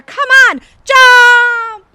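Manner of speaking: loud urgent call to jump; urgent shout. Acted. Guide shouting at the actor to jump. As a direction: yell at him to leap now. Urging escape.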